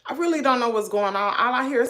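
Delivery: usual speaking voice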